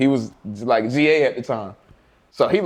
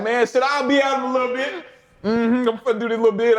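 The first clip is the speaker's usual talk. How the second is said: carefree; 7 semitones higher than usual. Retold or imitated. Retold casual reply from box.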